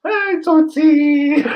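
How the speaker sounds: very high, loud, drawn-out mock cooing greeting; cooing pet-name talk. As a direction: imitate someone gushing over a cute toddler. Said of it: "ukuteketisa ngokumbiza Tsotsi".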